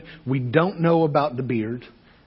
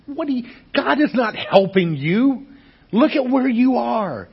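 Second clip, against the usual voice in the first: much higher, louder disbelief; high incredulous challenge; incredulous objection; 10 semitones higher, 5 dB louder than usual. Retold or imitated. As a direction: scoff that God isn't helping. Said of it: "a lot of us would look and say".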